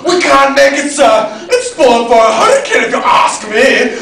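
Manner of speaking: loud, urgent objection; alarmed protest. Acted. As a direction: call out a warning to the captain. Officer protests in the storm.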